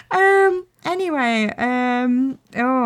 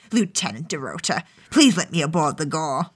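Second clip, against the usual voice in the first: low, quieter villainous voice; lowered, menacing character voice; Emperor Palpatine; 5 semitones lower, 3 dB quieter than usual. Acted. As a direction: pitch it low like an evil emperor. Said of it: "doesn't say it like Emperor Palpatine".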